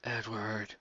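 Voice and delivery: soft ghostly whispered call; eerie whisper. Acted. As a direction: call him like a spirit from beyond. Whispered fanfic ghost voices.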